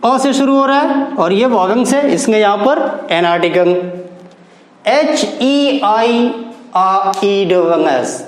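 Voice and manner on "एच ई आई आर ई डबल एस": letters spelled out very slowly; teacher spelling the word for students. Shown as a demonstration. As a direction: dictate each letter with pauses. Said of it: "एरस".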